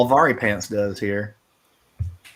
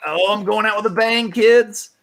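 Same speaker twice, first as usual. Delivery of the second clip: excited; 7 semitones higher than usual. Real, not acted. Hyped about the big move.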